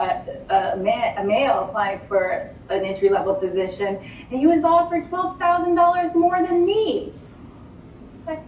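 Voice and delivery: ordinary talk, nothing marked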